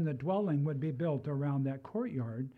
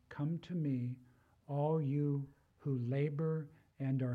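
solemn scripture reading. Acted. Preacher reading Jesus's invitation to the weary, measured and solemn. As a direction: read the words of Jesus gently, as a promise. Quoting Jesus's words in sermon.

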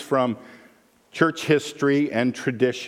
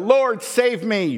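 cried out; 10 semitones higher, 4 dB louder, slower than usual. A narrator's reading. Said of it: "he cried out".

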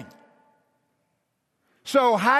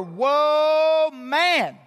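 louder, higher whoa stretched out; amazed whoa; 4 semitones higher, 4 dB louder than usual. Retold or imitated. Adam naming Eve, loud amazed whoa. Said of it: "he called her".

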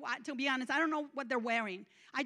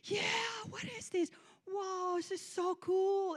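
higher-pitched happy amazement; joyful wonder; 7 semitones higher than usual. Retold or imitated. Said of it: "there was a joy in the air".

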